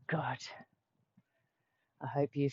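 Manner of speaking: soft whispered word. Acted. Teacher closing the story in a whisper. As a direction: breathe the word out.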